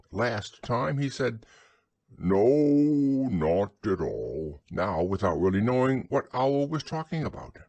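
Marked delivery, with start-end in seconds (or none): 2.2-4.6 slow, drawn-out reply; long, absent "no", slowing down; absent-minded. A narrator's reading. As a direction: answer vaguely without following the talk. Pooh answers without listening.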